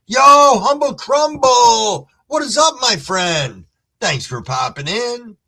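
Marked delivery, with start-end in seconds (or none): 0.1-3.7 shouted greeting. Real, not acted. Streamer shouts a welcome to a regular joining the stream. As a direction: holler a name across the room.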